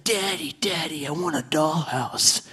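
hushed, slow mock child voice; imitated young son. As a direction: lightly mimic a pleading little boy. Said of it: "My son came up to me".

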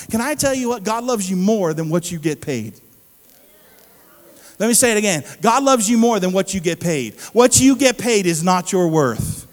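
very loud, emphatic proclamation; preacher's forceful repeated declaration; full-force preaching. Real, not acted. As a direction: drive the point home loudly.

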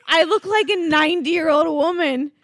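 crying with laughter; laughing. Real, not acted. Laughing at her own swollen-neck photos, in tears. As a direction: laugh it out while you say it.

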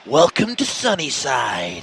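slow, lightly voiced cuddly bear villain; a hint of the Lotso bear, drawn out; Lotso impression. Host to co-host, showing off his Lotso impression. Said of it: "I do the best Lotso impression".

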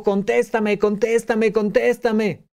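pleading insistently for an answer; whiny insistent contéstame; demanding. Retold or imitated. Demanding reply to unanswered message.

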